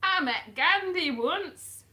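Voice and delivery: high, loud, drawn-out put-on accent; the Doctor. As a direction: announce it slowly in a badly faked accent. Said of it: "that's the doctor for you now".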